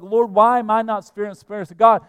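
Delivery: high, loud, hurried complaint to God; frustrated plea. Acted. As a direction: act out a worshipper feeling cheated. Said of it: "Have you ever felt this way?".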